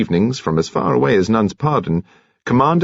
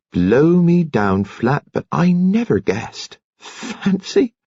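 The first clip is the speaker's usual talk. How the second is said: slow then much higher tickled amazement; delighted surprise; 9 semitones higher, slower than usual. Acted. Nurse muses over secret young love, tickled and amazed. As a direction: relish the discovery, gleefully.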